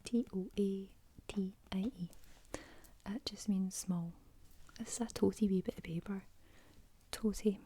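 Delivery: Scottish words ASMR whisper. Real, not acted. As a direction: whisper it gently like a bedtime secret.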